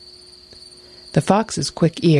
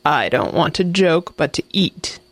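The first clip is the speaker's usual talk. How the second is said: ordinary delivery, nothing marked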